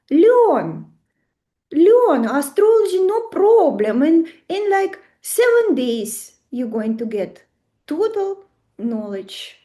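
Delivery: high, loud, pushy ad pitch; hyped ad voice. Pretend. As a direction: sell it like a cheesy Instagram ad. Mocking an Instagram astrology ad.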